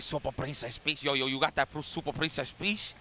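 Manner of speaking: put-on heavy Dominican accent, teasing. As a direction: imitate a Dominican homie's accent. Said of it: "that heavy Dominican accent".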